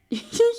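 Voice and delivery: high squeal of laughter; sudden laugh. Real, not acted. Reader cracking up mid-quote, high laugh.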